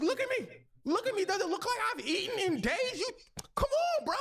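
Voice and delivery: put-on high, crying plea of a starving kid; pleading child. Adopted kid begging sister for help, high teary plea. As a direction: squeak it out like a hungry child begging.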